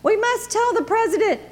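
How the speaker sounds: high, squawky panicked cluck; clucking hen. A narrator's reading. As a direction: cluck it like a frantic chicken. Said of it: "clucked Chicken Licken".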